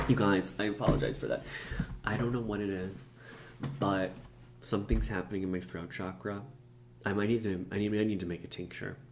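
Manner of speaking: ordinary talk, nothing marked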